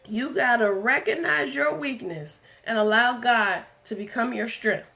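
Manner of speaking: usual speaking voice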